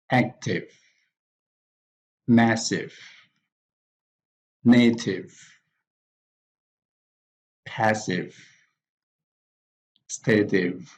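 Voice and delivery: AC-tive, MAS-sive, NA-tive, PAS-sive, STA-tive, each stressed at the front; first-syllable stress. Shown as a demonstration. Teacher models adjectives, first-syllable stress on active, massive, native, passive, stative. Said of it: "stress is also on the first syllable".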